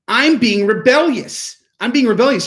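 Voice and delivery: put-on high, stretched-out toddler defiance; mock rebellious child. Voicing a crying child's thoughts to explain parenting, high mock defiance. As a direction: play a defiant little kid, pitched high. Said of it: "what's going on in their mind?".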